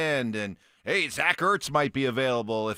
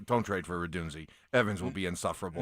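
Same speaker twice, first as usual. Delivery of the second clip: mock worried mutter; 8 semitones lower, 6 dB quieter, faster than usual. Mocking hosts' worried inner voice.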